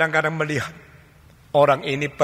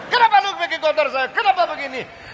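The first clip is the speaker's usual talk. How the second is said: high-pitched indignant outburst; hammed-up furious painter; angry complaint; 9 semitones higher than usual. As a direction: demand to know why, furious. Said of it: "Orang itu marah sekali".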